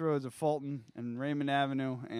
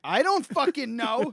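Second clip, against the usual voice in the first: exasperated; 8 semitones higher, 8 dB louder, slower than usual. Real, not acted. Exasperated, laughing.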